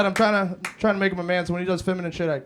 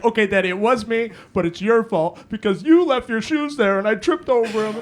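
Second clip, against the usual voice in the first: higher, louder mock kid protest; imitated blaming child; 5 semitones higher, 5 dB louder than usual. Son blames dad's shoes for broken lamp, mock protesting. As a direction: play a kid confessing, then shifting blame.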